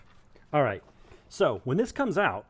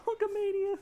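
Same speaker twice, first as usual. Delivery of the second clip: high, laughing repeat; laughing echo. Real, not acted. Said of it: "laughing echo".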